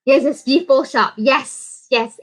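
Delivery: ordinary delivery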